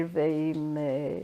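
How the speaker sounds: normal talking voice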